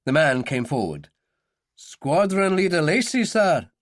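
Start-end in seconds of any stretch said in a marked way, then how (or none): 1.8-3.7 high, military address; formal service salute in the voice; reporting sergeant. A narrator's reading. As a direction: report to a superior officer.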